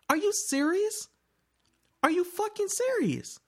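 pitched high, indignant and incredulous; outraged disbelief. Real, not acted. Ranting to friends about sneaker bots, high incredulous outburst. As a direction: throw the question up like you can't believe it.

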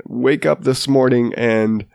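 normal talking voice

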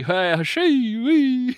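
slow, high, drawn-out New England shout; mock New England accent. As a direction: ham up a rural New England yokel. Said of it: "someone with this accent".

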